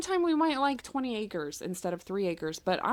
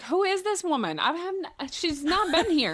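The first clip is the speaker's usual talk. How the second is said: higher, puzzled who-is-this; baffled; 6 semitones higher than usual. Retold or imitated. Viewer baffled by an unknown woman on screen, high confused asking. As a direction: ask it high and confused, as if a stranger walked in. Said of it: "And I was like".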